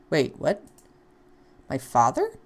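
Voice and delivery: ordinary delivery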